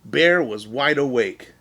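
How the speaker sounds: usual speaking voice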